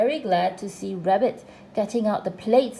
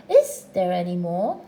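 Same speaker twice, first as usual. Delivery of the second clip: eager question, low then rising; a touch of haste, swooping up at the end; quick asking; 5 semitones lower, 6 dB louder than usual. A narrator's reading. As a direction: jump in with the question. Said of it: "asked Pooh quickly".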